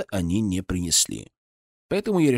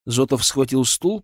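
plain reading voice

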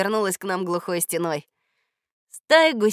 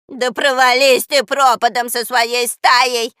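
louder, slow furious howl; screeching curse; 4 semitones higher, 9 dB louder, slower than usual. Acted. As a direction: curse the goose loudly. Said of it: "завопила Баба Яга".

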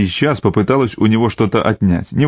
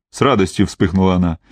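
plain reading voice

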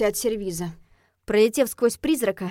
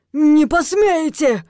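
loud, high, drawn-out cry; frightened, affronted exclamation; gasped alarm; 6 semitones higher, 10 dB louder, much slower than usual. A narrator's reading. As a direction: cry out in panicked outrage. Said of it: "ахнул он".

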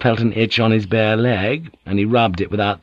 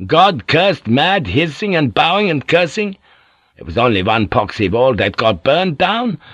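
plain reading voice

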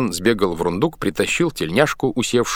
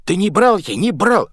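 panicked denial; 12 semitones higher, 5 dB louder than usual. A narrator's reading. Sailor denies under beating.

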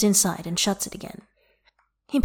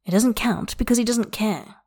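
ordinary delivery, nothing marked

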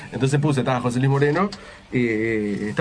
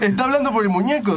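raised, high-pitched heckler's complaint; mock heckling yell; 7 semitones higher than usual. Host imitates an audience member exposing the ventriloquist, mock yell. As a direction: expose the trick like a heckler, with laughter. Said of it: "otro gritó".

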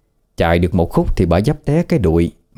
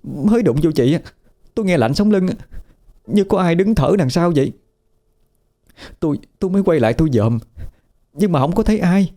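much higher, fast and stammering, still shaken; frightened rush; 11 semitones higher, faster than usual. A narrator's reading. Frightened woman tells her sister-in-law about the ghosts, high breathless rush. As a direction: blurt it all out in one breath, scared stiff. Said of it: "rồi nói một hơi, kiểu như sợ ai giành nói".

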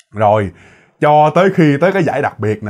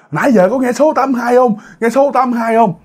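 high-pitched mock crowd in disbelief; retold outcry; 9 semitones higher than usual. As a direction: act out the bewildered crowd, pitched way up. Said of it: "nguyên một đám bắt đầu la làng".

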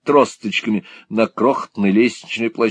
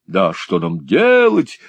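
faithful servant; 6 semitones higher, slower than usual. A narrator's reading. Lenville playing the servant consoling the lady, slow lament. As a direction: wail it like the loyal servant. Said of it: "говорит верный слуга".

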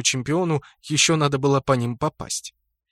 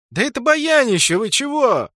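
indignant protest; 11 semitones higher, 6 dB louder than usual. Acted.

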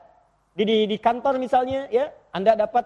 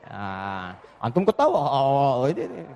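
ordinary delivery

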